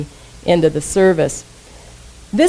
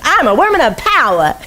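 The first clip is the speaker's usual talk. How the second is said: put-on Edwene Gaines's Southern accent; 7 semitones higher than usual. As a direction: imitate a charming Southern accent, pitched up. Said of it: "She has such a cute accent with it".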